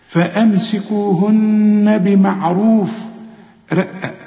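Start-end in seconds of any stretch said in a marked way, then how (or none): 0.1-2.9 slow فأمسكوهن بمعروف with a long hummed نّ joining the words; doubled nun, linked. Shown as a demonstration. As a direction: recite it joined, lingering on the nun of فأمسكوهن. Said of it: "وعند الوصل".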